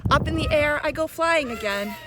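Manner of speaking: put-on lilting, sing-song reading of a children's poem; poem recitation. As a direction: recite it like a swing ride, rising and falling.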